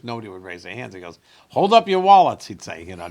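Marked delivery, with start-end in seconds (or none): 1.5-2.5 loud, high imitation of a comic's punchline; joking punchline. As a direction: throw it out to the crowd as a joke. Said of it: "just, like, jokingly".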